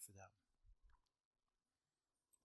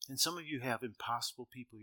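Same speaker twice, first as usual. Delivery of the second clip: normal talking voice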